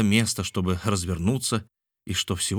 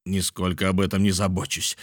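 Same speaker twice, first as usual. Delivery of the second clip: ordinary delivery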